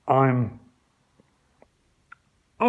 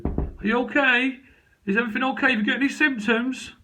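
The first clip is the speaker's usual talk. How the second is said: mock police concern; 10 semitones higher than usual. Police at the door asking about symptoms, mock-concerned and high. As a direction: put on a fussy concerned officer.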